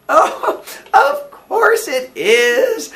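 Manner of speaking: high, loud, drawn-out exclamation; crying out. A narrator's reading. Landlady remembers Mister Weaver's name, crying out. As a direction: cry it out with a soaring voice. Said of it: "she cried".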